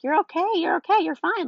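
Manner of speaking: high, singsong mommy comforting voice; retold reassurance. Mother soothing her fallen toddler.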